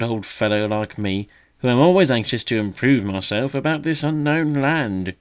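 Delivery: loud, high teasing mock gravity; mock solemn. A narrator's reading. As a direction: play at great seriousness to tease. Magpie teasing the young bird.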